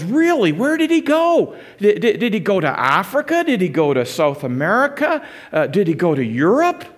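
high, loud, eager questions; eager surprise. Retold or imitated. Stranger quizzing the preacher about his missionary dad, eagerly surprised. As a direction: pepper him with excited questions.

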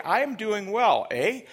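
high, loud mimicked Canadian "eh?"; overdone Canadian talk. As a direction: overdo the newcomer's Canadian eagerly. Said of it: "she's gotta maybe refine that".

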